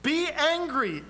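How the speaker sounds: "Be" lifted high and drawn out slowly; stress on Be. Shown as a demonstration. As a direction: lean hard and slow on "Be".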